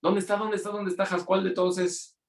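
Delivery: high-pitched, hurried searching questions; rushing new father. Retold or imitated. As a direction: re-enact running in, urgently looking for your baby. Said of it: "entré y corriendo ahí".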